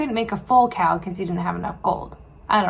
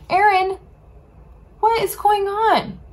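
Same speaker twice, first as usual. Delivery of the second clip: high, loud, confronting call; angry demand; 10 semitones higher, 4 dB louder than usual. Acted. Moses confronts Aaron over the calf, angry and loud. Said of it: "He was so angry".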